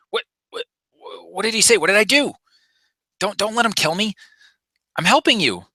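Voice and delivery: high, panicky pleading; startled panic. Acted. Jumpy Rhodian begs the Wookiee not to kill him. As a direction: yelp it out, scared for your life. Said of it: "kind of startled".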